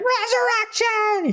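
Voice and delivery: very high, drawn-out falsetto squeal; mock scream. Showgirl keeps screaming as the crowd leaves, mock falsetto scream. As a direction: imitate her screaming it, stretched out. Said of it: "Carly's still screaming".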